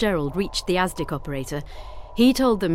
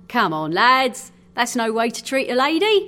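hearty sailor rallying his mates; sailor addressing comrades; 5 semitones higher, 5 dB louder than usual. A narrator's reading. Sailor to his gawping comrades, chiding. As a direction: chide the lads, higher and louder.

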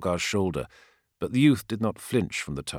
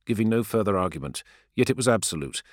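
plain reading voice

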